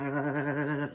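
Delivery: stuttering, juddering vocal noise; imitated shaking noise. Showing viewers how mad she was, imitated shaking noise. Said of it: "I'm just shaking".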